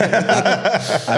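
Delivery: laughing while starting the story; words breaking into laughter. Real, not acted.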